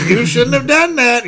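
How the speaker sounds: high menacing ghost voice; shrieking spooky warning; unearthly voice. Retold or imitated. Ghost voice in movie recap.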